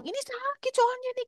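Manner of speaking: put-on high, soft little-child voice; child complaining. Son telling mother it hurts, high soft whine. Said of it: "Awalnya cuma bilang".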